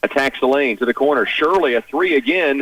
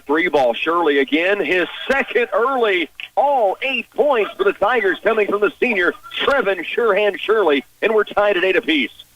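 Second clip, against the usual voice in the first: higher-pitched excited announcer call; 7 semitones higher than usual. Real, not acted. Announcer calling the tying three for the Tigers, excited. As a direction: lift your voice as the three drops. Shirley hits a three, ties game.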